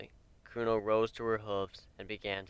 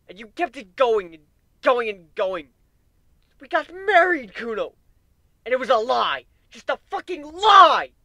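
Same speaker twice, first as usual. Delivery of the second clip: much higher and louder, a wavering furious yell; 12 semitones higher, 13 dB louder than usual. A narrator's reading. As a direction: hurl it at her, voice cracking with betrayal. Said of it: "yelled, stamping his hoof".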